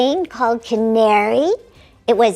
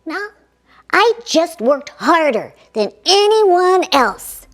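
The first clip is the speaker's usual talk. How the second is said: normal talking voice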